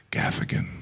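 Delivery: "Gaffigan" whispered, rolled; whispered Spanish surname. Acted. Comic imagining a Spanish name, whispered. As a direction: hush it like a fancy name.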